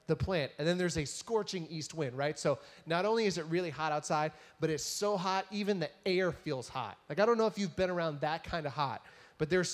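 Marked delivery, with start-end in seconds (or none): none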